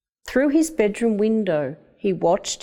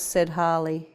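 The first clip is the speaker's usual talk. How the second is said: usual speaking voice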